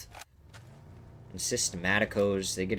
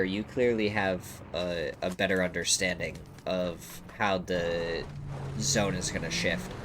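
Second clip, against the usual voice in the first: talking with a blocked nose; stuffy, blocked-up nose voice; 3 semitones higher than usual. Real, not acted. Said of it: "I can't breathe through my nose right now".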